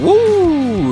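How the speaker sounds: high, loud party whoop; excited whoop. Acted. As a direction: cheer it out like the room is dancing. Celebrating while the yam bubbles.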